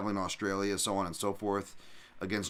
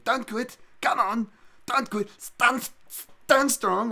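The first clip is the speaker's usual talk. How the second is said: put-on high, loud shouted Dutch-accented cornerman; Henry Hooft Dutch accent; 8 dB louder, slower than usual. Coach yelling at his fighter in a Dutch accent. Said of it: "I just love that Henry Hoof accent".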